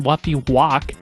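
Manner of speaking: ordinary talk, nothing marked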